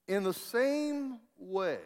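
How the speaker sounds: high, slow, ringing pulpit delivery; preacher builds to the key line; preaching. Acted. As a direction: proclaim the scripture to the congregation. Preaching the verse.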